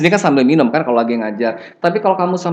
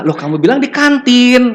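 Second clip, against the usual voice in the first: high, louder disbelieving protest; surprised objection; 9 semitones higher, 4 dB louder than usual. Retold or imitated. Lecturer to student caught lying, surprised. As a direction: catch the student out with raised surprise. Teacher quoting surprised self.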